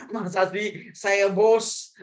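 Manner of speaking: mock-indignant boss, drawn out slowly. Acting a boss refusing to apologise. (bos yang pura-pura tersinggung, diulur pelan)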